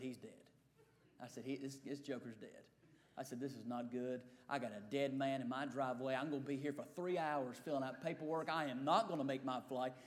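low, soft grumbling to himself; low, soft worried mumble about the dead man; dismayed muttering. Retold or imitated. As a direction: mutter the bad news under your breath. Panicked inner muttering, retold.

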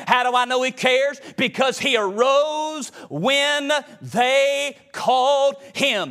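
high, driving revival preacher delivery; full-force preaching. Acted. Preacher to congregation about God in the storm, full-force preaching. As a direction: preach it hard in rolling, repeated phrases.

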